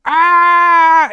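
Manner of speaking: high, loud shriek of fright; panicked scream. Acted. Elzerna runs into the mist, screaming high and loud. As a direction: let out a sharp terrified scream. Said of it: "She goes".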